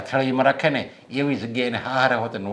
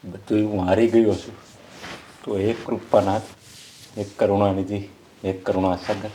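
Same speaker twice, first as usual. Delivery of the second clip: despairing prayer. Acted. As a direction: beg God for mercy under your breath. Father prays to God, desperate.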